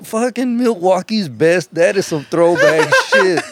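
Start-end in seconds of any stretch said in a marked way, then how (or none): 0.0-1.7 high, slow amazed drawl. Retold or imitated. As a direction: stretch it out in disbelief. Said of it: "Bro, I was like".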